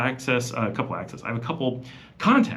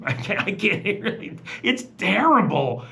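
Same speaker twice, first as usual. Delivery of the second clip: laughing; 10 semitones higher than usual. Real, not acted. Laughing at own bad Spanish.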